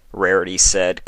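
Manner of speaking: ordinary delivery, nothing marked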